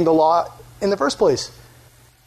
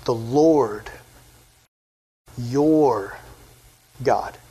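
"The Lord, your God" low, with long pauses between; word-by-word emphasis; 5 semitones lower, much slower than usual. Shown as a demonstration. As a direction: set "your" apart, drawn out and low. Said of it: "Don't miss those words around the word God".

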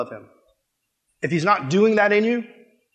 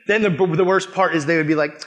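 ordinary talk

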